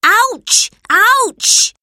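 loud, very high cries of pain; yelled ouch. A narrator's reading. Albert yells after falling in a heap. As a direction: yelp it out in pain. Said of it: "yelled Albert".

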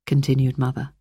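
usual speaking voice

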